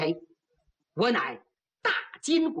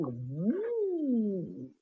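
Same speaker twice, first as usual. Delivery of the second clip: whooshing staff; 3 semitones lower, 5 dB quieter than usual. A narrator's reading. 棍子呼啸打来.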